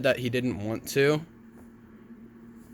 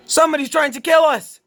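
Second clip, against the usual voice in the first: high, loud panicked cry; frightened shout; 10 dB louder, faster than usual. Acted. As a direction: yell it in terror. Realizes someone is attacking.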